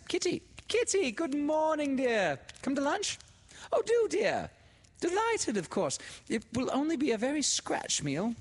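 put-on high, gushing society-girl voice; bubbly, delighted telephone talk; excited phone chatter. A narrator's reading. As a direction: greet a dear friend in a loud, high gush.

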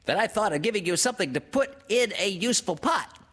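very high, quick piglet voice; tiny animal bubbling with pleasure; happy. A narrator's reading. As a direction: gush it fast and high. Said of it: "said Piglet happily".

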